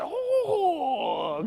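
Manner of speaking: high drawn-out "oh"; high mock-impressed coo; impressed teacher. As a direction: imitate an amazed, approving teacher. Teacher impressed by his attempt.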